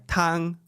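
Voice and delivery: TANG high and level; wrong flat tone. Shown as a demonstration. Customer mistakenly ordering soup, TANG in a flat tone. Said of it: "I accidentally say".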